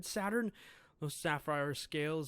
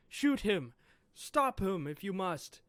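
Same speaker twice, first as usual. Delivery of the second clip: a loud, higher command to shoot; shouted order; 5 semitones higher, 6 dB louder than usual. Acted. Saturn orders the Marines to stop Kuma, shouted command. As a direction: bark the order at the troops.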